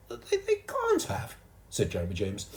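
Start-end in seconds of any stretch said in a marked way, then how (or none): 0.1-1.3 very high, slow stammer; stunned disbelief, stammering high; shocked little boy. A narrator's reading. Jeremy James shocked.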